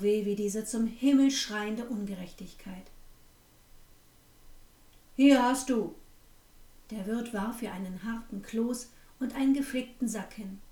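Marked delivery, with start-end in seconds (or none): 5.2-5.9 loud, contemptuous throwaway line; curt shout. Acted. Innkeeper tossing the girl a hard dumpling, loud and contemptuous. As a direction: fling the words at her, loud.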